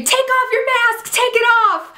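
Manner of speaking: high mock yell, the girls' panicked demand; mock screaming. The girls yelling at the masked attacker, a high mock scream. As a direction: squeal it out like the cornered girls. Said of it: "the girls all just start screaming".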